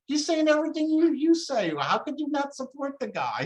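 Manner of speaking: high-pitched, pleading disbelief as retold argument; incredulous appeal. Speaker to his realist friends who won't back Trump, incredulous.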